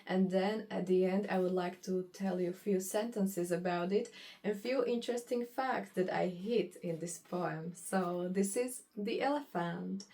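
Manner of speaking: ordinary talk, nothing marked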